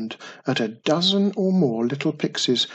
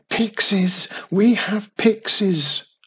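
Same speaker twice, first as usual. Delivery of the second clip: higher and louder, panicked cry; panicked shout; 7 semitones higher, 5 dB louder, slower than usual. A narrator's reading. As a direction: Mum crying out in panic at pixies. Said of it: "shouted in panic at the top of her voice".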